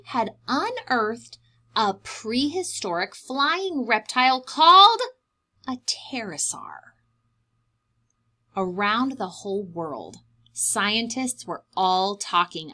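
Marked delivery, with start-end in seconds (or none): 5.7-7.0 whisper. Acted. Hushed naming of the discovery.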